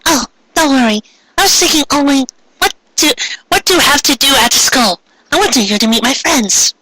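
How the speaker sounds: put-on high, polite girl voice; a shy schoolgirl introducing herself; Ami's voice. A narrator's reading. Ami greeting the new girl, high and polite.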